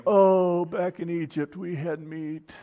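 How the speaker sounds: drawn-out nostalgic moaning, hammed-up; mock complaining. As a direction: imitate grumblers pining for old meals. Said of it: "they started complaining".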